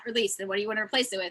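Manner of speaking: ordinary talk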